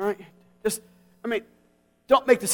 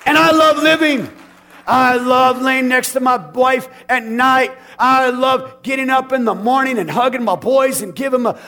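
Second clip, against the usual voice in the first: loud, higher shouted praise; full-force preaching; 6 semitones higher, 7 dB louder than usual. Acted. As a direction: lift the room with joy. Preaching climax on loving life.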